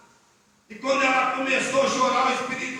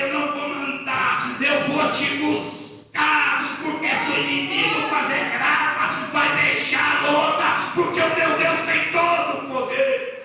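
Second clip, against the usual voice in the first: raised, driving sermon shout; fervent preacher's climax, pitched up; full-force preaching; 3 semitones higher, 5 dB louder than usual. Real, not acted. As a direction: build to a triumphant declaration.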